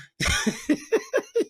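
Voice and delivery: shrill giggle burst; high squealing laugh. Real, not acted.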